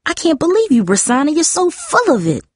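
high-pitched outraged outburst; accusing, voice leaping high; indignant cry. A narrator's reading. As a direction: cry it out in fury. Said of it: "Darcy cried".

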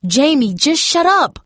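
high, forceful hushing of a sister; exasperated outburst. A narrator's reading. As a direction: cut her off urgently. Darcy snaps at sister mid-fight.

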